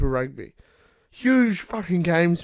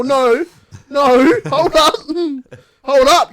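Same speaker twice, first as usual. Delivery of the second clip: loud, high objection; laughing protest; 10 semitones higher, 11 dB louder, slower than usual. Real, not acted. Teased fan objecting to his friend, loud amused protest. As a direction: cut in loudly to stop the teasing.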